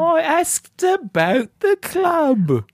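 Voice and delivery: very high, hammed-up English lady voice; subdued English lady. Greeting the ladies for the listener, high English lady accent. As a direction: falsetto mock English lady, stretched out. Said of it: "subdued English lady".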